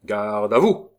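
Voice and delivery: barked order. Acted. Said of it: "ordonna un militaire".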